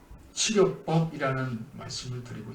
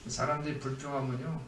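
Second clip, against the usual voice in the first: ordinary delivery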